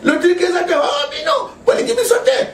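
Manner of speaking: high-pitched imitation of complaining critics; mimicked high outcry; mock criticism. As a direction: act out the rival party's outcry, voice jumping way up. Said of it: "parti mosusu so ba critiquer".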